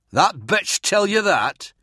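slow, high, contemptuous sneer. Acted. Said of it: "Merrin sneered back".

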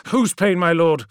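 anxious appeal to a suffering lord; pressing concern; urgent question. Acted. Ammon urgently questions Magnus.

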